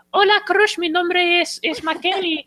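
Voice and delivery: put-on tiny, very high kid voice in Spanish; mock squeaky child voice; imitated child. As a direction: pipe up like a little Spanish-speaking kid. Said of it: "It's like these kids are gathering around".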